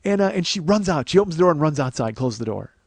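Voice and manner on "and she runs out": ordinary talk, nothing marked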